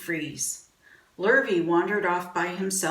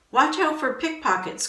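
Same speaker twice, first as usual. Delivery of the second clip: higher-pitched parental warning; cautioning father; 8 semitones higher, 4 dB louder than usual. A narrator's reading. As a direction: call out a caution to the children. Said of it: "cautioned their father".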